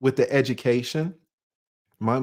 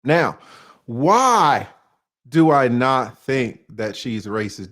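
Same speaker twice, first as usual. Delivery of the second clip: ordinary delivery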